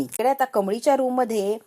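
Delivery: ordinary delivery, nothing marked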